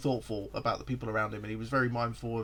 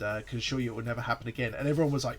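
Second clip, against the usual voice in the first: ordinary talk, nothing marked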